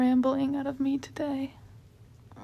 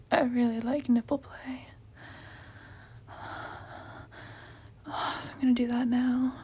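whispering. Real, not acted. Whispered talk.

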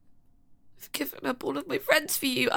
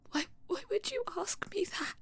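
hurt whisper; 4 semitones higher, 9 dB quieter than usual. Acted.

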